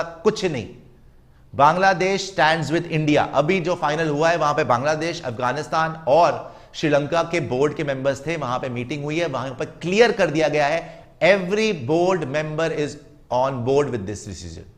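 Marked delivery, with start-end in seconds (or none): 1.5-3.3 slow emphasis. Real, not acted. Firm declaration to viewers. 11.2-14.7 very slow, each word spelled out; slow emphatic statement. Real, not acted. Stressing board decision to viewers.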